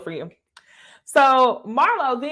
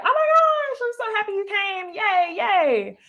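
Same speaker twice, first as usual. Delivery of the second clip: hammed-up delight, pitched way up; mock gushing welcome; 10 semitones higher than usual. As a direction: imitate a group of friends cooing hello. Imitates ladies greeting the late arrival.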